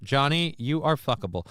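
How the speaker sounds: ordinary delivery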